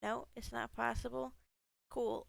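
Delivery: ordinary talk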